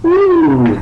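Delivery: high, loud, excited ooh; delighted "ooh". Real, not acted. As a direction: let out a high-pitched ooh of discovery. Delighted at finding a good spell.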